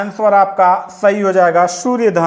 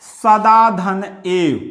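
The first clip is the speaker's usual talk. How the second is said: सदा धन एव said very slowly, each part apart; slow sandhi split; much slower than usual. Shown as a demonstration. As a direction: spell out सदा धन एव slowly for the class. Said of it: "तो यहाँ पे क्या हो जाएगा". (धीरे-धीरे, हर हिस्सा अलग)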